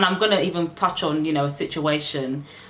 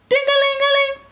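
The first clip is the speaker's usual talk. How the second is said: very high, louder sing-song bell ringing; bell imitation; 5 dB louder than usual. Vlogger tells viewers to press the bell, high ringing imitation. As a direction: trill it out high like a little bell.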